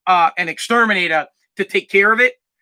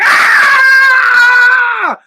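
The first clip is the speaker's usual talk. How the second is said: very high, loud imitated scream of horror; mom's scream; 9 dB louder than usual. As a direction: shriek like mom watching the bat die. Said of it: "My mom's like".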